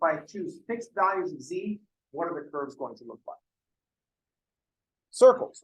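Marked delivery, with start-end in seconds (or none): 5.1-5.6 high and loud announcement; loud, higher exclamation; emphatic answer. Real, not acted. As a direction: call out the answer. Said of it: "answers own question".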